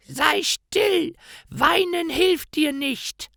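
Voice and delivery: very high, slow scolding crone voice; harsh witch. A narrator's reading. Witch scolds the weeping Gretel, high and cold. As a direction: cut off the girl's crying coldly.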